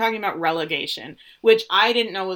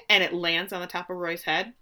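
ordinary talk

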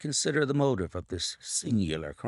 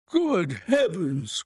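high, drawn-out cry of amused astonishment; amused exclamation; 8 semitones higher, 3 dB louder, much slower than usual. A narrator's reading. Colonel learning the fit was staged, amused astonishment. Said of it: "cried the colonel, laughing".

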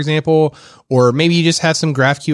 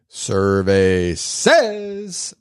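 a slow, drawn-out game show call; game show announcer; 6 semitones lower, 4 dB quieter, much slower than usual. Acted. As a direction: stretch it like a game show host. Game show segment intro.

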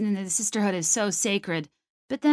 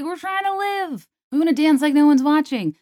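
much higher, carefree hammed-up teen voice; mock party girl; 7 semitones higher, 6 dB louder than usual. Host mocking blackout sorority teens, higher carefree voice. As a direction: play a tipsy freshman who just wants to party. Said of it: "nineteen-year-olds that are just like".

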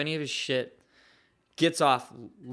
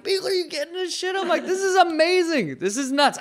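much higher, fast excited urging; thrilled, calling to his filming friend; hyped amazement; 13 semitones higher, 3 dB louder than usual. Retold or imitated.

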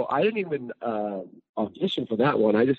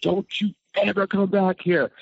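retold yell; 8 semitones higher than usual.

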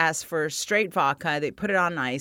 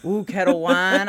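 usual speaking voice

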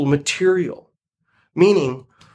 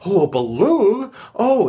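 retold excitement with much higher pitch; excited; 6 semitones higher than usual. Eeyore hears of his birthday balloon, excited. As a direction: voice a character's rising delight at a balloon. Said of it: "he gets excited and excited".

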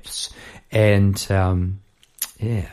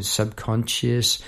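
usual speaking voice

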